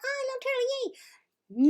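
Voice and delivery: tiny high, slow squeal; cute turtle. Acted. Normal turtles sucking into their shells, cutely high-pitched. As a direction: play an adorable happy turtle. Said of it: "that's great, and it's cute".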